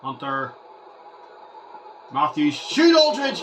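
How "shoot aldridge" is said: high, loud command; real excited yell; urgent shout. Real, not acted. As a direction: scream at the player to shoot. Yelling at player to shoot.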